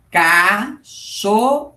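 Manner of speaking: slow, drawn-out cachorro; syllable by syllable. Shown as a demonstration. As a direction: stretch cachorro out slowly, as the child reads it. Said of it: "Então, aqui, ó". (cachorro bem devagar, sílaba por sílaba)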